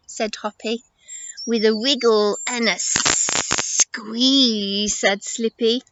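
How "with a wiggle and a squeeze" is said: slow, hissy stretched words; slippery snake. Acted. Snake answering how she'll pass rocks, slow and hissing. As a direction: draw out the s in squeeze.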